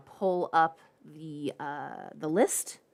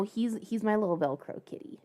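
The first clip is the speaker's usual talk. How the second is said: ordinary talk, nothing marked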